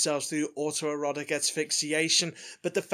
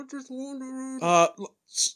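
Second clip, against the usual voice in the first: muffled through a hand over mouth; 9 semitones higher, much slower than usual. Acted. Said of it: "Could you speak up a little bit".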